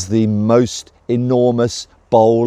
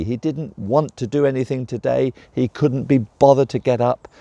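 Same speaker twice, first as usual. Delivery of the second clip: ordinary delivery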